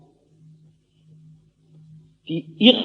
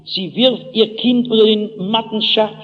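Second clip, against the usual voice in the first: ordinary delivery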